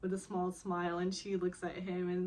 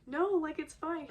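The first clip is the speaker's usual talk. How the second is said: imitating heroine; 8 semitones higher, 4 dB louder than usual. Retold heroine brushing him off.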